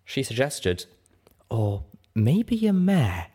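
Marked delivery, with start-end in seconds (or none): 1.5-3.3 slow, sultry, smooth voice. A narrator's reading. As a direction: melt it like chocolate, slowly. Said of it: "With a sultry voice as smooth as melted chocolate".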